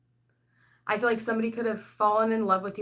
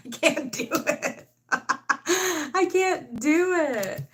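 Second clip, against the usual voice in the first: high, slow, laughing "I can't do it"; hammed-up despair dissolving into laughter; 8 semitones higher, 5 dB louder, much slower than usual. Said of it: "laughing at her own dread".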